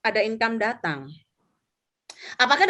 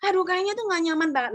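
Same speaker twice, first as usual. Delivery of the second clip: high-pitched, hurried mock whining; retold plaintive lament; imitated tearful complaint; 8 semitones higher, much faster than usual. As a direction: pour out a put-on tearful grievance. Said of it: "nangis-nangis".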